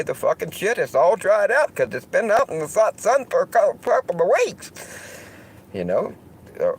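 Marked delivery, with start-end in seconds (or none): 0.0-4.7 much higher, louder Southern character voice; loud Southern twang, dumbing it down for the crowd; southern accent Diginomad. Acted. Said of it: "Diginomad had to come along and say".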